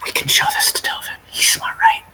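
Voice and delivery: hushed, voiceless whisper. Acted. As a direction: whisper it secretly to a companion. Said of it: "I whisper to her".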